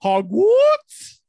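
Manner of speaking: put-on high, loud, very slow joke accent; drawn-out mock posh; mock British accent. A podcaster repeating her cohost's word in a loud joke accent. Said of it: "everything is just screamed".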